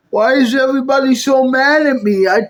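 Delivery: normal talking voice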